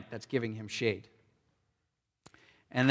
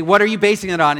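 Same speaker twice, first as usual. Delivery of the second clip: frustrated demand; 6 semitones higher, 9 dB louder, faster than usual. Retold or imitated. Voicing frustrated self.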